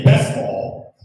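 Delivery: loud callback cry; called out loud. Shown as a demonstration. Comic calling out his callback to the crowd, loud. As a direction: announce it like a catchphrase. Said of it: "something like, say".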